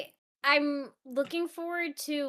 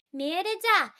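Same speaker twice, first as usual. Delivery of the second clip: put-on higher, louder scolding call in a Scottish accent; Scottish queen mother; 9 semitones higher, 5 dB louder than usual. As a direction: call the princess like a stern Scottish mum. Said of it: "I'm constantly sitting there being like".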